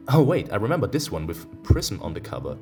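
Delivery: usual speaking voice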